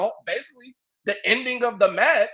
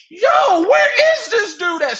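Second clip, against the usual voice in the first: mock shout; 10 semitones higher, 8 dB louder than usual. Calling out for missing commentator.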